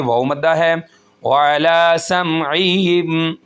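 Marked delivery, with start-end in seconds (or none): 1.2-3.4 वाला slowly stretched; recited phrase. Shown as a demonstration. Teacher reading slowly to show the stretch. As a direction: draw out the लाम a bit. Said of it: "एक अलिफ के बराबर खींचेंगे".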